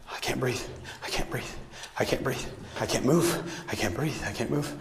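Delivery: faint, breathy imitating of a struggling victim; barely voiced, as if air is running out; weak breathless retelling. Speaker quotes injured Meg gasping she cannot breathe, faint.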